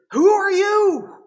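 a blind father straining to place a voice; old Isaac asking. Acted. Isaac to the son at his side, slow and high.

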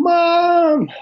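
high excited yell across the yard; screaming for Mom. Retold or imitated. Boy calling his mother outside, excited yell. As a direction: call out to Mom in a burst of wonder. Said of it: "I'm screaming".

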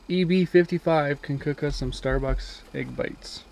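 ordinary talk, nothing marked

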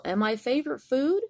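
high, loud rising tease; teasing threat. Acted. Mouse teasing the Gruffalo, high playful threat. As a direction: build it up playfully. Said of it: "the mouse said".

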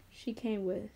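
ordinary delivery

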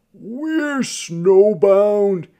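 loud, high, drawn-out announcement; announcing. A narrator's reading. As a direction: call it out slowly to a whole carriage. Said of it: "he announced".

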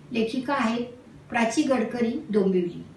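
usual speaking voice

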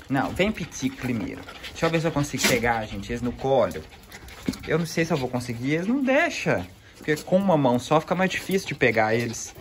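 usual speaking voice

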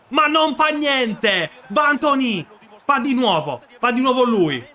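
high, loud cheer for the scorer; excited shout. Real, not acted. Gamer cheering Anthony's goal, loud excited cheer. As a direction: cheer him on, overjoyed.

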